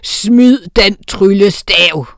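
loud, slow troll command; loud slow troll order. A narrator's reading. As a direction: order her to drop the wand. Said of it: "kommanderede han".

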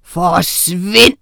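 menacing snarl with a punched second syllable. A narrator's reading. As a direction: snarl the order to clear off. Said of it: "snærede han".

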